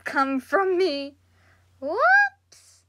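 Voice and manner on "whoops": startled whoops. Real, not acted. Sudden slip, embarrassed.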